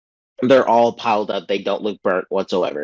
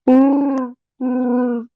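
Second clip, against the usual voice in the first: mock hum; 3 dB louder than usual. Character's odd hum.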